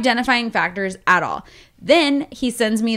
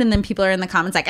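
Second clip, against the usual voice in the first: ordinary delivery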